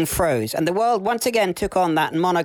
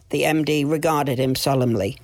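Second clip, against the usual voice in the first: ordinary delivery, nothing marked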